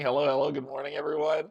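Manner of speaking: ordinary delivery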